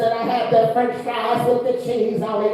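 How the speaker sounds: high, sing-song sassy voice; Katt Williams impression. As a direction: talk with sass, almost singing. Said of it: "he talks like this".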